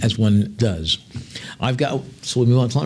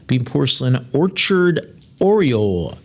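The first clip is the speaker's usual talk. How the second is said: normal talking voice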